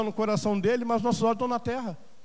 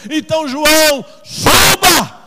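high, loud shout of a summons; preacher voicing God at full force; thundering call; 6 semitones higher, 11 dB louder, much slower than usual. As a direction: cry out SUBA as a long, booming command. God's call to John, shouted.

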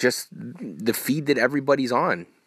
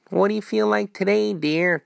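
lightly voiced doting partner, pitched higher; mock sweet partner; 8 semitones higher than usual. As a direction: tease it like a sugary spouse asking. Mock sweet question at home.

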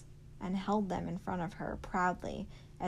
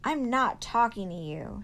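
louder, huffy retort; shouted back; 4 semitones higher, 7 dB louder than usual. Acted. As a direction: throw it back at him. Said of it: "she shouted back".

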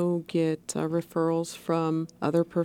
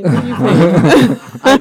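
laughing; 16 dB louder than usual. Real, not acted. Guest laughs passing question.

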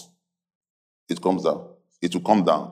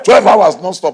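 higher, louder bragging; mock boasting; 6 semitones higher, 5 dB louder than usual. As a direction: brag it loudly at the pastor, imitating a show-off. Mimics young people boasting to pastor.

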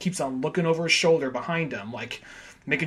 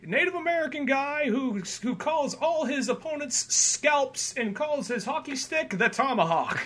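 mock announcer; 11 semitones higher than usual. Announcer introducing goon.

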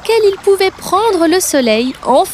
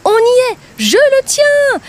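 triumphant cry; 10 semitones higher than usual. Acted. Bird yelling after pulling out the meat, triumphant. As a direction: shout it out in triumph. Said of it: "cria-t-il encore".

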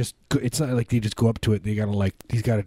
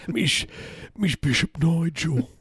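very high, drawn-out mock British voice; British Bishop Nigel; 9 semitones higher, slower than usual. Comic trying out a British bishop's name, exaggerated British accent. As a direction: squeak out a silly British accent. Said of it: "Nigel is, like, my go-to British guy".

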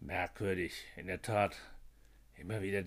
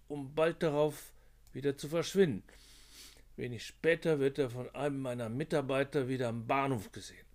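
higher-voiced officer; 6 semitones higher, 5 dB louder than usual. Narrator voices Konzelmann reporting.

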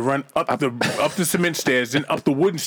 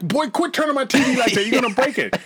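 higher scolding mom voice, half sung; a mother scolding her son off the TV; 9 semitones higher than usual. Retold or imitated. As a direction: scold the kid like his mother did. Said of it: "And what did your mother say?".

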